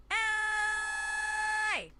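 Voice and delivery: long, high sustained shout; drawn-out high cry; giving it all. Shown as a demonstration. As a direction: hold the vowel high with full force. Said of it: "giving it my all".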